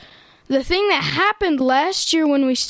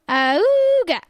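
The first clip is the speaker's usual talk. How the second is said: car horn sound; 5 semitones higher than usual. Shown as a demonstration. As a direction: bark out an old car horn. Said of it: "It goes a little something like this".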